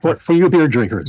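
loud, right into the mic; booming too close to the microphone. Real, not acted. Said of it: "Now you're too loud".

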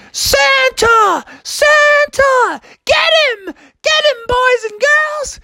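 very high excited shrieks; chasing kids. Acted. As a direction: squeal like a pack of children on the hunt. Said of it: "all the kids started chasing after him".

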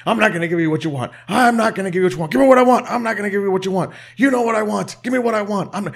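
high-pitched, fast back-and-forth argument; hammed-up shouting match; mock screaming. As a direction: imitate two wrestlers screaming over each other. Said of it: "just screaming into it".

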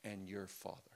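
soft, dropped down for your Father; hushed reveal. Shown as a demonstration. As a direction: let it fall to a hush. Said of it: "now he's gonna add something".

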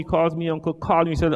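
ordinary delivery

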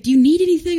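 high, loud, urgent imitation; hammed-up frantic offer of help; mock alarmed driver. Driver to child just hit, loud high mock alarm.

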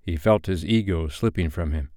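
ordinary delivery

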